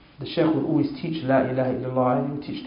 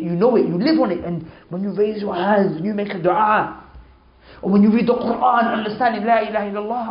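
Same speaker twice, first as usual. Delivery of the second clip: higher, louder, building sermon climax; fiery preaching; 6 semitones higher, 5 dB louder than usual. Acted. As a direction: preach it with rising fire. Preaching builds to full force.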